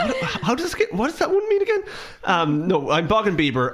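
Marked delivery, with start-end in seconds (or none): 0.0-1.8 much higher, laughing through the question; giggling confused question. Real, not acted. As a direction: squeak it out while laughing.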